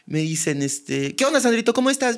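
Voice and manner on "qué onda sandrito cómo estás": higher, friendly village call; townsperson greeting. Retold or imitated. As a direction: hail him like an old neighbour.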